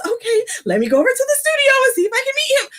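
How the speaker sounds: hammed-up girl rushing off to meet him; eager fan girl. Imagining herself chasing Trevor Noah, squealing and fast. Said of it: "And they're like".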